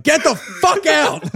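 hammed-up slow shout; mock screaming character. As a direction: drag it out like a hammed-up angry man. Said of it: "he's like".

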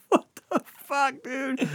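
high mock outrage; shocked friend. Friend Ken reacts to news.

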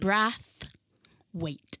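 "Brathwaite" split in two, short a in "Brath"; careful name pronunciation. Shown as a demonstration. Woman spells out her surname by sound, "Brathwaite" in two slow parts. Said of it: "when I say to people".

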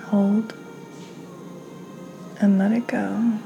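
ordinary delivery

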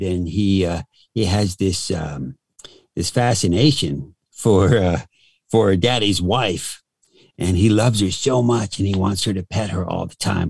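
put-on voice of a randy, disgruntled dog; perverted corgi voice. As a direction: talk as a pissed-off, pervy little corgi. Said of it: "so the corgi sounds like this".

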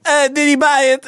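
high-pitched mock Italian accent; hammed-up Italian conspirator. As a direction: ask it in a put-on high Italian voice. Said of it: "stuttering in these accents".

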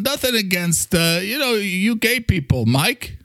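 high, awkwardly backpedalling New Yorker; Mister Coffee. Acted. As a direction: stumble defensively in a New York character voice.

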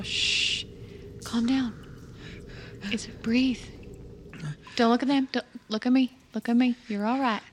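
soft, gentle shushing; quiet the panicking kid gently; calming a hyperventilating child softly; soothing hush. Acted. Calming the scared creature.

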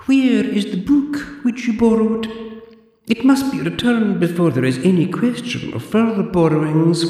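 put-on high, prim old librarian voice; haughty zombie librarian, clipped and proper; librarian Deirdre. Deirdre lectures the borrower in a high prim voice.

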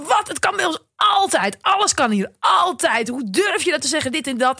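high, indignant mock outburst; retold fury. Mother retelling her outburst at her son, indignant and high. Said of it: "dus ik was woedend".

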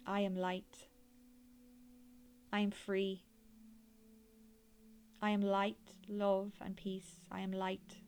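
airy breathy mystical healer. Acted. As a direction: gently guide them inward like a healer. Leads a guided meditation.

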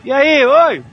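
put-on high rowdy boys calling out; catcalling teens. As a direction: holler at passing girls. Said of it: "Começou de novo o vozerio".

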